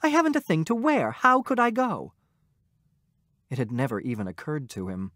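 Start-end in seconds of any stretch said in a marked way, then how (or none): 0.0-2.1 high, fast, impatient wife. A narrator's reading. Wife complaining to husband she has nothing to wear, impatient. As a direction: snap it out, fed up and impatient. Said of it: "said impatiently".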